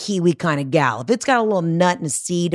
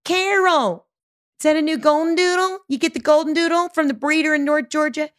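acted long-distance yoo-hoo; calling across the street; 10 semitones higher than usual. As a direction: call out to a neighbor over the road. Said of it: "This is me yelling from across the street".

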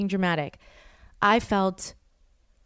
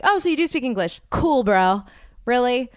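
sarcastic retort; 4 semitones higher, 4 dB louder than usual. Retold or imitated. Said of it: "I'm like".

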